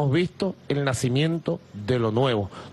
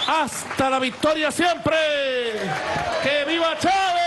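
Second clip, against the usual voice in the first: climbing higher into shouted cheers; booming rousing speech; 7 semitones higher than usual. Real, not acted. Closing chants ending the speech. (arenga enardecida a gritos)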